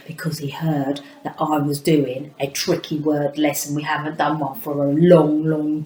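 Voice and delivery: put-on whispered aside to the children; conspiratorial stage whisper. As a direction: lean in and whisper it like a secret.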